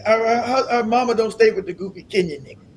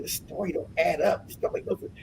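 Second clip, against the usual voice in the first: soft, low private muttering; inner voice; 5 semitones lower, 12 dB quieter than usual. Retold or imitated. Speaker retelling doubts kept in his mind, hushed. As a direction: drop to a near-whisper, thinking. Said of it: "I said, in my mind".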